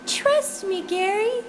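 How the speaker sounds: high, sweet persuading voice; coy wife voice. Acted. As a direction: coax him in a high sugary voice. Owner's wife pushes a hug.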